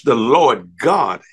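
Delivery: "Lord God" stretched out slowly and weightily; stress on Lord God. Real, not acted. Preacher points congregation to the name, stressing "Lord God" slowly. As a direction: lean hard and slow on "Lord God". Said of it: "I've emphasized the term".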